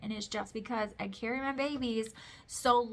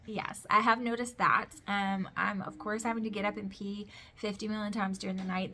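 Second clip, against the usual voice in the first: ordinary talk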